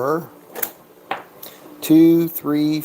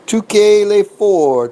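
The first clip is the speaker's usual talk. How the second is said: higher and louder bet call to the dealer; raised call of the bet to the dealer; 6 semitones higher, 5 dB louder, slower than usual. Real, not acted. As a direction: announce the bet across the table.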